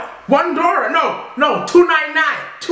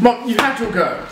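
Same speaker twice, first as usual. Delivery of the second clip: ordinary delivery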